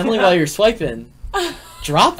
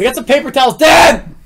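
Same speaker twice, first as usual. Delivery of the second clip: high shout building to his name; calling out; 7 semitones higher, 7 dB louder than usual. Real, not acted. Host steering Dan to paper towels, calling out. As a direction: holler for his attention.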